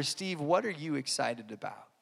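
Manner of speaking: low, hushed needling voice of the devil; devil's whisper imitated. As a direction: drop soft and low, like the devil in his ear. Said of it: "the devil started whispering in my ear".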